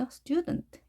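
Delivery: "student" with an unstressed, reduced ending; weakened second syllable. Shown as a demonstration. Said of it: "약하게 해주는 거죠". ("student"의 뒤를 약하게 발음)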